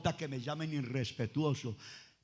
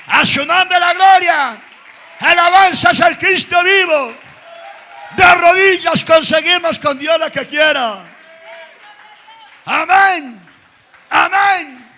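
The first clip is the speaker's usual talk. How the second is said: high, loud, slow shouted praise; preaching at full force; 12 semitones higher, 21 dB louder, slower than usual. Acted. Preacher shouting praise to the congregation, full force. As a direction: lift the whole church with it.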